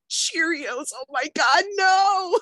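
mock horror at the snack menu; appalled disbelief. Mom retelling daycare snack talk, loud appalled high cry. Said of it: "And I'm like".

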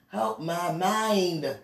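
slow, stretched-out plea; drawn-out plea. Real, not acted. Woman begging God to help her mind, drawn-out plea. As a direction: drag out each word, lingering on "mind".

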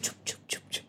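whispered shh-ing; soft whispered shush; hushing whisper. Retold or imitated. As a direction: hush someone in a whisper. Retells being hushed while pinched.

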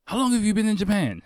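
high-pitched, eager question; pumped stranger. Retold or imitated. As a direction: imitate an over-keen stranger striking up a chat. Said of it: "super pumped to talk to me".

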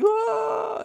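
very high loud shriek; hammed-up alarmed scream; mock shriek. Guest shrieking at the host, hammed-up shriek.

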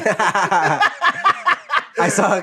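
laughing. Real, not acted. Laughing at wrong clip.

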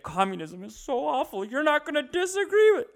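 hammed-up verge-of-crying plea; mock a grown man about to cry; mock quivering voice. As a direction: voice climbing high, imitating near-tears. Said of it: "his fucking voice is quivering".

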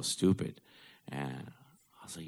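ordinary talk

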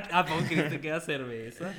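teasing question through laughter; laughing. Real, not acted. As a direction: laugh while you ask it. Teasing about the drink.

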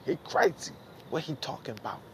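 high-pitched put-on mocking voice; mock bystander. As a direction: squeal it like a baffled onlooker. Mimicking onlookers calling him crazy.

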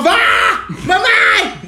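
mock cry for mom. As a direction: call out for mom, hammed up. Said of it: "ela gritando". (grito exagerado chamando a mãe)

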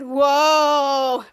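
long, loud, held "whoa"; drawn-out dopey wonder; amazed. Acted. Goomer watching the slap fight, loudly amazed.

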